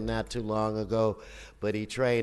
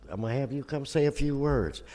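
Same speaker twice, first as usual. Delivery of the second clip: ordinary talk, nothing marked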